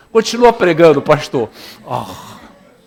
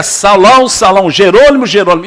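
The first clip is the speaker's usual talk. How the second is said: louder heavenly call; 3 semitones higher, 6 dB louder than usual. Acted. A lightly voiced heavenly voice calling Jerônimo, louder. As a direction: call the name from above. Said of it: "ouviu uma voz que lhe dizia".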